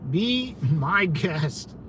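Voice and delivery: drawn out, slow and dismissive. Real, not acted. Streamer slowly brushing off the caller, drawn-out and dismissive. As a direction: stretch it out like you're done with them.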